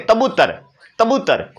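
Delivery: तबूतर with क turned into त; lightly imitating a lisping toddler saying तबूतर; child's lisp. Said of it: "फिर वो क्या कहेंगे".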